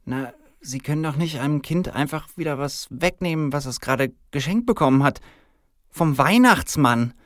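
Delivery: high-voiced, squirming objection; uneasy woman employee. Frau Fuchs windet sich unbehaglich.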